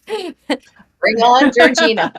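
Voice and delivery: slow, loud, playful plea to the universe; half-joking, calling out to the sky for help. Acted.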